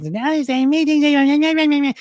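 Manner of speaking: very high squeaky helium voice. Shown as a demonstration. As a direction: squeak it out like you just sucked helium. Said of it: "as if they just sucked on a helium balloon".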